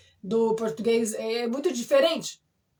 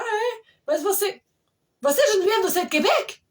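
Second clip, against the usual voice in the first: high, louder, excited question; retold surprised recognition; 9 semitones higher, 4 dB louder than usual. Party guest recognizing the Centro Quebec teacher, retold with surprise. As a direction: act out a guest suddenly realizing who this is.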